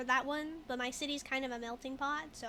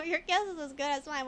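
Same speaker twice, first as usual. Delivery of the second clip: ordinary talk, nothing marked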